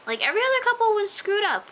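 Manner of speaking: high, loud indignant outburst; exasperated rant. Real, not acted. Fan ranting about perfect couple's breakup, exasperated. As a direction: protest loudly at the unfairness.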